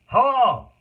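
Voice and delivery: high, loud hammed-up greeting; shouted hello. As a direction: burst in with a big shouted hello. Bursting in with a greeting.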